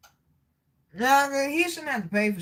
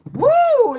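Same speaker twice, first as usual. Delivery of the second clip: high loud woo; excited whoop; 10 dB louder than usual. Real, not acted. Cheering herself on, loud high whoop. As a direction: let out a big whoop.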